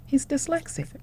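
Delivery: hushed whisper of the hard news; breathless whispered reveal. Real, not acted. Revealing the diagnosis.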